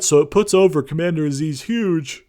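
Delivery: talking through a yawn; stretched mid-yawn words. Real, not acted. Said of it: "I'm yawning".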